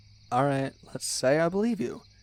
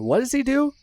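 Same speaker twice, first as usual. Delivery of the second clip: high, louder mock outrage; indignant question; 10 semitones higher, 5 dB louder than usual. Telling the friend about Santa, mock outraged. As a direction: build up to the betrayal.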